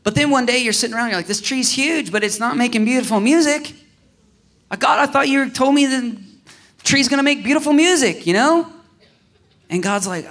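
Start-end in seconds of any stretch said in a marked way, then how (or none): 1.3-8.7 whiny complaint. Retold or imitated. A believer whining to God about the tree, raised and plaintive. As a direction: moan to God that the tree makes no music.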